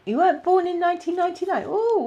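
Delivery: high, loud disbelieving squeal; surprised. Real, not acted. Psychic to a young viewer, surprised high exclaim.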